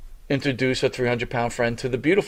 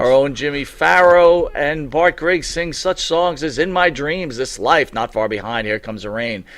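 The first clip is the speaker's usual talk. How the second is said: show announcer read; 4 semitones higher, 4 dB louder than usual. Acted. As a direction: read credits like a host. Reading show credits.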